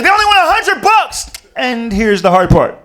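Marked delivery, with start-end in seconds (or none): 0.0-1.3 high, loud frantic yell; mock kid yelling, much higher; panicked shriek. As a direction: scream it in a panic.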